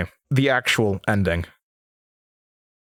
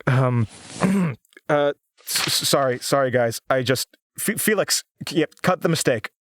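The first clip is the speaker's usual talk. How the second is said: stammering and flustered, losing the thread; caught off guard; 4 semitones higher than usual. Real, not acted. Host breaking off mid-read and apologizing to the producer, flustered. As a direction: stumble over the words as the composure slips. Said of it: "Just caught off guard".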